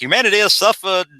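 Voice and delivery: lofty speech in a put-on Southern accent. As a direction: declaim it high with a Southern accent. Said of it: "slipping into, like, a Southern accent".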